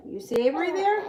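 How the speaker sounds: high coo. Real, not acted. Talking to baby.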